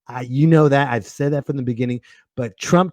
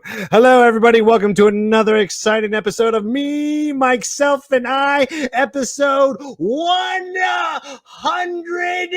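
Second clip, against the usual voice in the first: big host opening; 9 semitones higher than usual. Acted.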